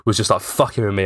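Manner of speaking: usual speaking voice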